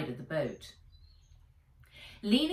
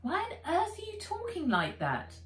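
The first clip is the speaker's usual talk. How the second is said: much higher, baffled question; incredulous; 12 semitones higher than usual. Acted. Nat baffled at her robot's new voice, incredulous high question. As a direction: ask it in disbelief.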